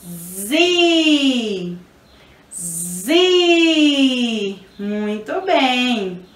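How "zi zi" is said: ZI with a long, held I; teacher sounding out a long "zi"; syllable sound demo. Shown as a demonstration. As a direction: buzz the Z, then stretch the I in "zi". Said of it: "O som do Z com o I fica".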